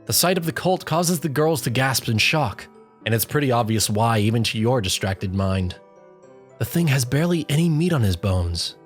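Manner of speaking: plain reading voice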